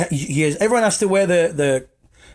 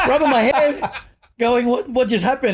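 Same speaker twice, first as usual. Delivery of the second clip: laughing; 7 semitones higher than usual. Real, not acted. Laughing retelling the head rip.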